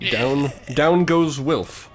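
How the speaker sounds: normal talking voice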